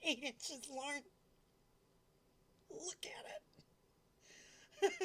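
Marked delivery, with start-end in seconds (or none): 2.7-4.9 stretched-out breathy whisper; slow whisper. Pretend. Streamer repeats the donation line to chat as a slow whisper. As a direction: hush it slowly like a secret.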